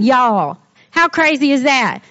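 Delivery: ordinary talk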